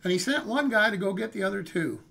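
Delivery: ordinary talk, nothing marked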